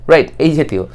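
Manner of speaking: ordinary talk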